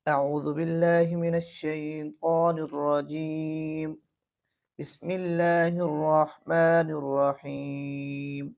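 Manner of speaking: slow, melodic tajweed chanting; slow measured Quran recitation; Quranic recitation. Shown as a demonstration. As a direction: recite slowly in tajweed style.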